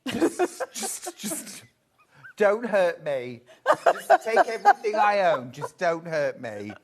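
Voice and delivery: mock pleading. Mock victim of scary toy.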